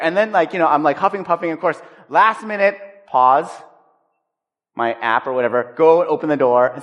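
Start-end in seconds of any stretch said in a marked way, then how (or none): none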